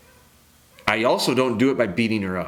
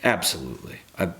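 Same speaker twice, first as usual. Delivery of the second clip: ordinary delivery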